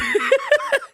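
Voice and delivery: quick high-pitched laugh; a brief amused squeal; short high laugh. Real, not acted. Laughs after the joke.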